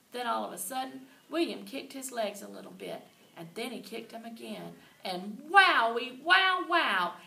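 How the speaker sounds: ordinary delivery, nothing marked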